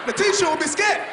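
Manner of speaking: loud, high comic shout; shouted punchline. Real, not acted. Comic telling the crowd, big shout. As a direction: belt it out to the crowd.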